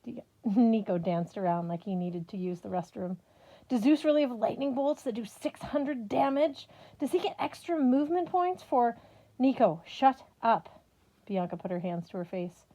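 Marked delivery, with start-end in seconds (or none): 3.7-9.0 high, loud rapid-fire questions; boy gushing about his card game; excited gamer kid. Acted. 9.4-10.8 loud, slow exasperated order; big sister snapping at her brother; sharp shut up. Acted.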